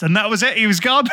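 ordinary talk

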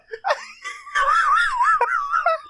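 high crying-laugh burst; shrieking laugh. Real, not acted. Friend cracking up mid-rant, shrieking laugh. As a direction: let out a shrieking, tearful laugh.

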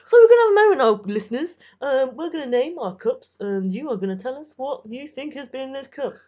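loud, fast, bright presenter; hammy DJ on air; radio host. Pretend. Radio host addressing listeners.

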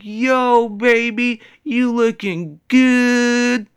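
high, loud, slow drawn-out pickup line; catcalling Puerto Rican guy. Retold or imitated. Man in stairwell hitting on a lady, slow loud catcall. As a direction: lay on the smooth catcall. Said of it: "He's like".

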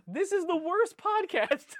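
high, slow comic lament; exasperated despair. Real, not acted. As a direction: wail it in exasperated mock despair. Mock despair at derailed podcast.

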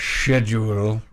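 "Schedule" drawn out slowly with a SH start; British-style schedule. Shown as a demonstration. Co-host showing off his odd pronunciation, "Schedule" slowly said SHED-yool. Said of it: "said the word schedule like that".

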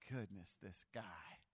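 low, soft exasperated sigh of a line; quiet mock disbelief; exasperated head-shake. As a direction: mutter it like shaking your head at him. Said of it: "shake our heads and go".

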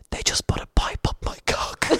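hoarse confiding stage whisper; acted-out hushed confession. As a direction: whisper it as a shameful secret to a mate. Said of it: "I kinda whispered him over".

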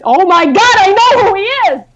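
loud, high-pitched excited outburst; sudden realisation. Retold or imitated. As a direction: blurt out the epiphany, pitch soaring. Acts out her sudden realisation.

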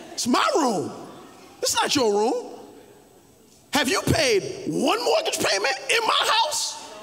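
hammed-up parent shouting; mock parent rant. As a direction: scold the kid about the mortgage. Parent claims the room.

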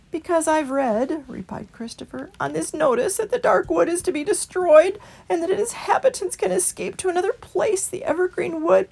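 meek small creature pleading for the way; Christopher. A narrator's reading.

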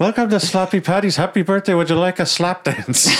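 high mock Irish host, laughing through it; a giddy Irish club host greeting the birthday guest; Irish accent. Host welcoming the birthday customer in a mock Irish accent. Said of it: "Irish strip club".

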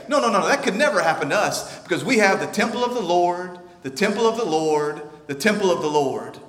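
smug, dismissive denial; a hint of complacent crowd voice; mock smug protest. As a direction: brush the warning off. People mocked as smug.

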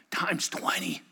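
breathy hushed stress; whispered emphasis. Real, not acted. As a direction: whisper it to make it land. Emphatic aside.

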